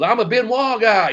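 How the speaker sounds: high, loud play-by-play call; excited commentator imitation. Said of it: "putting it over huge".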